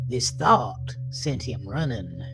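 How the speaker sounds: usual speaking voice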